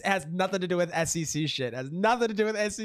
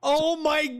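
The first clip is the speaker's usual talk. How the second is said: normal talking voice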